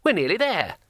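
high, cheery encouragement; cheerful. A narrator's reading. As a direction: sing out the good news brightly. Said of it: "he said cheerfully".

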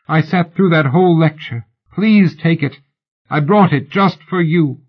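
woman begging the lady to take her gift; desperate pleading. Acted. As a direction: plead earnestly, near tears. Fan begs lady to accept.

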